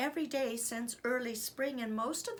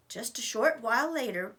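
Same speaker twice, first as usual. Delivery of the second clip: plain reading voice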